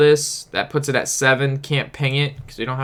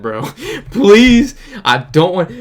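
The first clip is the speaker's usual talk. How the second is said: higher, louder retold begging; exasperated plea; 5 semitones higher, 6 dB louder, slower than usual. Driver begging a man walking up to his car, exasperated plea. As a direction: plead to be left alone, half laughing.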